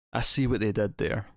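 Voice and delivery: ordinary talk, nothing marked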